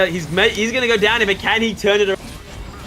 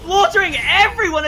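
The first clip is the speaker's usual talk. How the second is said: loud, much higher hype call; excited shout; 10 semitones higher, 4 dB louder than usual. Real, not acted. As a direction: scream the play as it happens. Caster calls game-ending fight.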